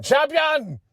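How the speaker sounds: very high yell of a champion; catchphrase shout. Acted. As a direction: shout it out like a battle cry. Demoted character shouts his catchphrase.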